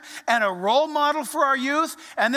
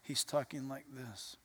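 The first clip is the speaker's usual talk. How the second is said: very low, very soft murmur; barely-there low voice; hushed, downplayed; 11 semitones lower, 17 dB quieter than usual. Shown as a demonstration. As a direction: drop right down to a humble near-whisper. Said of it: "if Paul normally talked like this".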